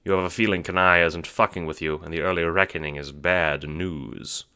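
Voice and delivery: ordinary talk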